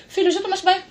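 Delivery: high, far-off mother calling her son; a fussy mom checking on her kid; mock mom. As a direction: mum voice asking about the bath.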